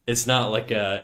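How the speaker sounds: ordinary delivery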